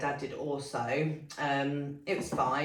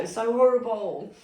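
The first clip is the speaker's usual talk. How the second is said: higher and louder, voice welling up with real emotion; emotional, close to tears; 7 semitones higher, 9 dB louder than usual. Real, not acted. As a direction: let the memory break through as you say it. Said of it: "it's making me really emotional talking about it".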